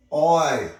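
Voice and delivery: loud, high "oi" shout; sudden booming shout; loud call. A narrator's reading. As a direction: holler to catch their attention. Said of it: "said a loud voice".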